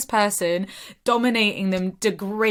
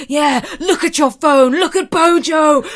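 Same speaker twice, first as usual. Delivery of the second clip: high, loud posh British tirade; hammed-up posh British yelling; posh British shout; 9 semitones higher, 10 dB louder than usual. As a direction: bellow like a plummy English dom. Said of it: "posh British voice".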